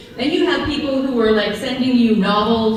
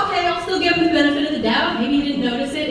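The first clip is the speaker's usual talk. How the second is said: fast self-talk; 3 semitones higher, faster than usual. Retold or imitated.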